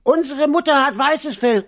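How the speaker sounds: put-on very high kid voice calling out; protesting little goats. A narrator's reading. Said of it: "riefen sie sogleich".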